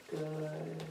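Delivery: very soft, drawn-out praise after a sigh; calm praise. Shown as a demonstration. Trainer rewarding an excitable puppy for settling, calm. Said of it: "tell him good in a calm voice".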